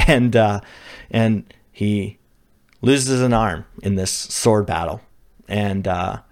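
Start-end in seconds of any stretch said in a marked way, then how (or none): none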